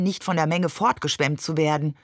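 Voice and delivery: ordinary delivery, nothing marked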